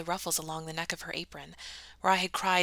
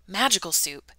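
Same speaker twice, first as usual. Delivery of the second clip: usual speaking voice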